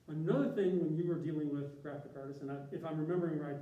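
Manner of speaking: ordinary talk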